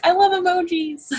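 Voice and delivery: high-pitched imitation of an enthusiast; mock excited gushing. Mimics excited blue-type person.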